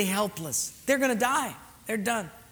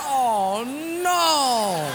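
loud, long falling "no"; mock dismay; 4 semitones higher, 4 dB louder than usual. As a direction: stretch it into a comic cry of despair. Realises there is no air.

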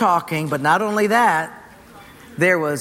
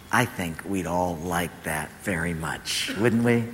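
gentle sermon close; 9 semitones lower, 5 dB quieter than usual. Acted. Pastor closing the message to the church, soft and lowered. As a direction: draw the congregation in quietly. Quiet preaching exhortation.

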